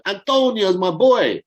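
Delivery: mock Italian accent of the manager, slow and high; bad Italian accent. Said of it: "Sorry for that horrible accent there".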